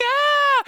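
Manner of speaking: high, loud imitated scream; mock scream. Sisters fooled by their brother's prank, mock scream. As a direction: shriek like frightened little sisters, hammed up. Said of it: "they'd be like".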